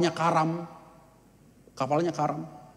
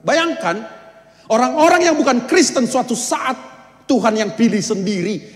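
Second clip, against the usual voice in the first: higher, louder, fired-up preaching; fervent preaching exclamation; 9 semitones higher, 6 dB louder than usual. Real, not acted. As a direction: urge the congregation to imagine it. (seruan khotbah yang berapi-api)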